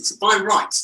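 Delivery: usual speaking voice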